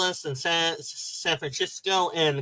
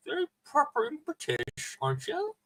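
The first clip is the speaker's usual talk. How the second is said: much higher, softer mock posh British accent; terrible British accent; 11 semitones higher, 6 dB quieter than usual. Teasing a friend as a proper British lady, in a high put-on British accent. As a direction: tease her in a bad upper-class British accent. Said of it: "I had a terrible British accent when I'm attempting it".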